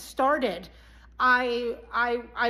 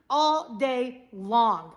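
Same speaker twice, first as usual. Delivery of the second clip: "all day long" slowly, word by word; slow, weighty "all day long"; drawn-out emphasis; 3 semitones lower, much slower than usual. Shown as a demonstration. Stretches phrase out for emphasis.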